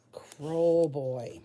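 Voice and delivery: ordinary talk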